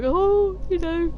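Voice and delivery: dog's voice. Retold or imitated. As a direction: voice the dog Princess begging to follow her around. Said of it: "Princess following me ... be like".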